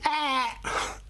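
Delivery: high startled gasp; scared ah. Shown as a demonstration. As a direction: gasp as if you saw something scary. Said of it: "Imagine you've seen something scary".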